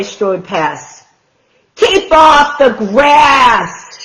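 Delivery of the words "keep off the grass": loud, higher and slow; slow grumpy shout. Acted. Hank shouts at the passing cowboy, loud and slow. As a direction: shout the warning slowly. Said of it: "shouted Hank".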